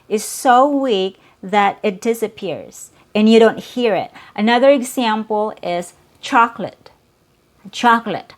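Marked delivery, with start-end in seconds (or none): 6.2-8.3 "chocolate" as CHAHK-lit, middle vowel dropped; two-syllable chocolate. Shown as a demonstration. Teacher giving learners the native example, "chocolate" as CHAHK-lit. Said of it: "You only hear two".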